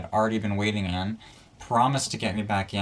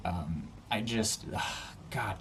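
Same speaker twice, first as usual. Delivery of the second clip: ordinary talk, nothing marked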